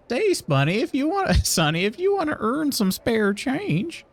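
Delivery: high, put-on voice with a stumble and laugh; high-voiced character making an offer. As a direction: pitch it up and stretch the offer out. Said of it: "his voice is perfect".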